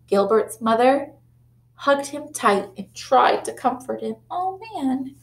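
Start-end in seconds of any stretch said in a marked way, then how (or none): none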